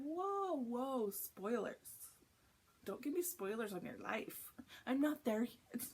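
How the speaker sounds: high-pitched playful protest; mock alarm. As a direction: cry out in half-kidding objection. Acts out objecting to spoilers.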